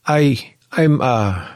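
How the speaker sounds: high, loud flustered stammer. Acted. Clara meeting Xander, stammering. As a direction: fumble for words.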